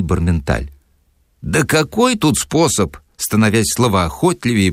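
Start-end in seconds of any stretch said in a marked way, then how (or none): 1.4-3.0 higher, slow, talkative boor; lightly voiced loutish Sharikov; Sharikov's voice. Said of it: "объяснил Шариков". (Шариков, слегка озвученный, болтливо)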